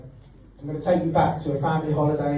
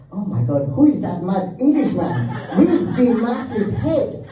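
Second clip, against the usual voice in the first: amazed Spanish crowd; 6 semitones higher than usual. Retold or imitated. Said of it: "these Spanish people going".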